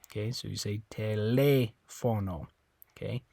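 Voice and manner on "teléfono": TELÉFONO as te-LEH-fo-no; TELÉFONO stressed on the accented E; stress on second syllable. Shown as a demonstration. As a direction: lean slowly on the LE of TELÉFONO. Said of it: "where you're gonna stress it".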